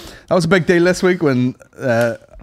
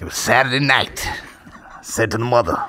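Dave Chappelle impression; 5 semitones lower, 4 dB quieter than usual. Stand-up setting up a mother story, low impression. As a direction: tell it lower and unhurried. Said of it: "I'm shit at impressions".

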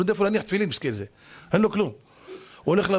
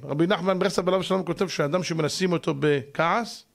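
normal talking voice

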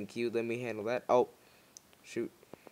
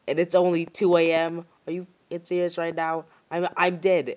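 panicked yelling; 5 semitones higher, 7 dB louder than usual. Real, not acted. Panic over low battery.

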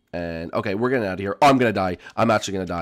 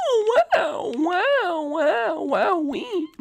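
high squeaky wows rising out of a laugh; squealing delight; much slower than usual. Real, not acted. Gamer thrilled at two achievements, high squeaky glee.

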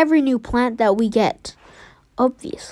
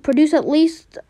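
normal talking voice

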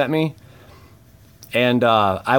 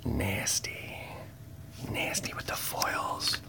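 slow, hushed whisper; whispered admiration, drawn out slowly; 14 dB quieter, slower than usual. Real, not acted. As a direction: savour it under your breath. Admiring a foil card.

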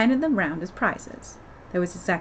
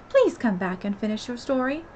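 ordinary delivery

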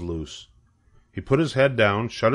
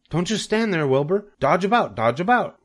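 high, urgent goose commands; crying out orders; 8 semitones higher than usual. A narrator's reading. Goose commanding cornered pig, high and urgent. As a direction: call out quick orders. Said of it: "cried the goose".

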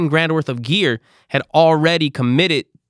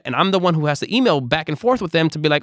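ordinary delivery

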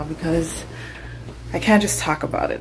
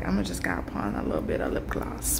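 quick, much lower, soft whisper to herself; whispered thought; 13 semitones lower, 10 dB quieter, faster than usual. Retold or imitated. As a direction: murmur the plan under your breath. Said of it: "And I was like".

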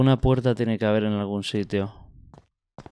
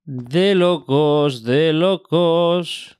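slow, stretched and higher; drawn out; 5 semitones higher, much slower than usual. Real, not acted. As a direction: savour each word slowly. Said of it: "repeats it drawn out".

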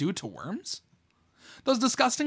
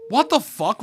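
loud, higher shocked outburst; now it lands, blurt it out loud; 5 semitones higher, 5 dB louder than usual. Real, not acted. Gamer reacts to a cutscene twist, loud shocked outburst.

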